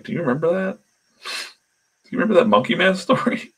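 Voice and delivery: high, excited put-on voice asking a friend; high eager question in someone else's voice; imitating Kirkman asking. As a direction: play him recalling it with glee. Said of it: "to say, like".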